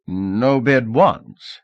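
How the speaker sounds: very slow, drawn-out auction count; auction call. Acted. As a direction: call the count slowly to the room.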